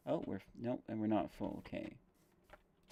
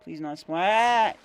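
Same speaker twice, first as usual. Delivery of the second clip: nervous plea; 7 semitones higher, 9 dB louder, slower than usual. Real, not acted. Risky jump, pleading not to die.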